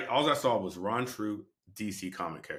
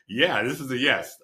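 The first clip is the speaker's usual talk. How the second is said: laughing, higher, louder eager acceptance; laughing yes; 6 semitones higher, 9 dB louder than usual. Retold or imitated.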